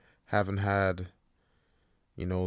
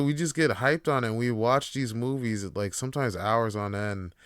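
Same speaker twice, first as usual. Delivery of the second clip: normal talking voice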